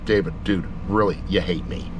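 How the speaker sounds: ordinary talk, nothing marked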